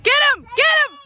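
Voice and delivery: loud high-pitched shout; yelling from the sidelines. Real, not acted. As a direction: yell it at the players. Urging defense from sideline.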